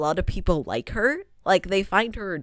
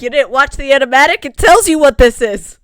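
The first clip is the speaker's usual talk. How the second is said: loud exasperated retort; 5 semitones higher, 12 dB louder than usual. Acted. Answering the confused viewer.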